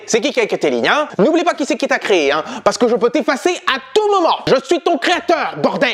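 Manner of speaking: menaçant. Acted. Le créateur menace son personnage.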